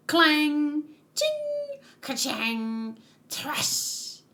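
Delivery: voiced metallic clangs, one very high; playful comic-book fight sound effects; clashing sword sounds. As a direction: act out clanging blades with the mouth. Voicing manga fight sound effects.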